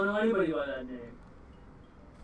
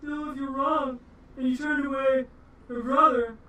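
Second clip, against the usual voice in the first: louder, higher warning; threatening orc guard; 8 semitones higher, 9 dB louder than usual. Acted. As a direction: warn them as the guard. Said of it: "goes on the side like".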